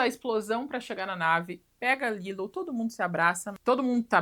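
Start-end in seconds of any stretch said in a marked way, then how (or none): none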